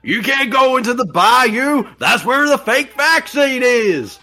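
high shouted warning; mock crazy local warning. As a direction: yell it like a swamp hermit. Mocking a movie character.

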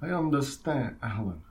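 lightly French godfather speech; a hint of a French Don Corleone; French accent. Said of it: "How would we do this in a French audience".

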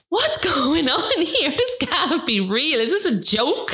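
can't believe what she's seeing; laughing disbelief. Retold or imitated. Retold disbelief as video explodes.

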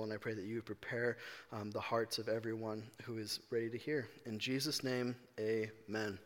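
soft, low, reverent prayer voice. Acted. Pastor praying to open the sermon, soft reverent prayer. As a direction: pray quietly and humbly before preaching. Opening prayer before the message.

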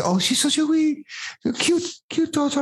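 high, badly faked Scottish old lady; a wobbly mock Scottish shop lady; terrible Scottish accent. Shop lady fawning over the baby, in a hammed-up Scottish accent. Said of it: "I had a terrible accent".

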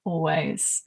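ordinary talk, nothing marked

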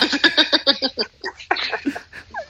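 high nervous giggle; nervous laugh. Real, not acted. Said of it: "Esa risa nerviosa".

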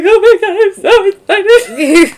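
very high, loud mock-crying squeal; tearful excited Shauna. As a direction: wail it with excitement, imitating her. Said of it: "Shauna's crying".

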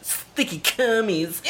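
ordinary talk

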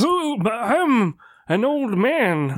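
put-on high, slow old man voice; weird old man voice. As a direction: croak out your age slowly. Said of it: "making that weird, weird voice".

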